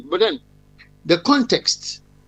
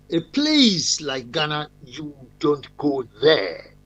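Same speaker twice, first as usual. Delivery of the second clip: slow lecturer quote; 2 semitones lower, slower than usual. Retold or imitated. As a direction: drag out "you don't go there" slowly like a stern lecturer. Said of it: "He said".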